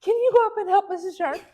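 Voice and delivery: high, fast anxious ask; hurried request. Retold or imitated. Secretary asking the UPS man, high fast anxious request. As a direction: rush the favor to the delivery man. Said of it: "And she goes".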